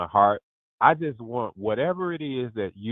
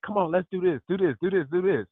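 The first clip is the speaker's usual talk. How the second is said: pushy cheerleading; 8 semitones higher than usual. Retold or imitated. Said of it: "I'm treating Paula G as if she's a young".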